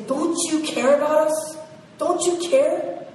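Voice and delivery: high, loud desperate cry; desperate plea. Acted. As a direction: beg for help through the storm. Disciples cry out in storm.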